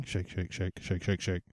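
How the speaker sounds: very low, hushed rhythmic muttering; spoken sound effect. Shown as a demonstration. Giving the sound effect of shaking hands, low hushed chant. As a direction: make a soft, low handshake noise. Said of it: "here's the sound effect of us shaking".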